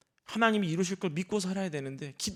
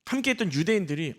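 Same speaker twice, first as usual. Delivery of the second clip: ordinary talk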